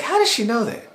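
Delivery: high-pitched stunned question; surprised disbelief. Retold or imitated. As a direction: squeak it out in shock. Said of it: "I was like".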